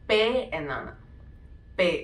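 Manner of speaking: പേ as "pay", long e; German letter P. Shown as a demonstration. Teacher showing German P. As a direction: say പേ like "pay", not "pee". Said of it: "പി നമ്മൾ pronounce ചെയ്യുന്നത്".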